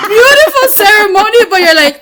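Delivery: laughing loudly through the words. Real, not acted. Mother recalling son's graduation, laughing out loud. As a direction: crack up while saying it.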